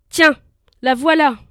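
loud, furious retort. Acted. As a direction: spit it out in fury. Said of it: "blanc de rage".

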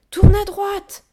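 high, loud yell; shouted directions. A narrator's reading. Child shouts directions to the gorilla at the wheel, yelling. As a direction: call it out across the noise.

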